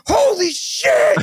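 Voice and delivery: high, drawn-out mock scream; retold scream. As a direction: stretch it out in high-pitched mock panic. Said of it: "I start screaming".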